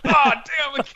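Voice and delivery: high-pitched, laughing exasperation; mock frustration. Scammer caught by fake name, mock frustrated cry.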